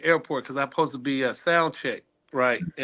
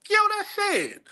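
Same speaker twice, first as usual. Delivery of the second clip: much higher, thrilled recognition; excited fan. Retold or imitated. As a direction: spot a celebrity on TV and squeal it. Fans recognizing him on TV.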